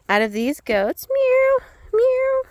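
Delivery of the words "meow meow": squeaky high meow to call the animals; high cat call. Real, not acted. Calling the goats like a cat.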